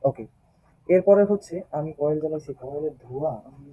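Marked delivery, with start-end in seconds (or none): none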